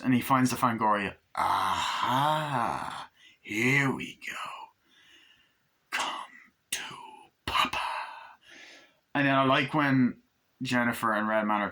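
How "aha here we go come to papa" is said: slow, drawn-out stage whisper; gloating whisper. Retold or imitated. Chucky finds the Fangoria, slow gloating whisper. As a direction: whisper it with long gaps, savouring each word.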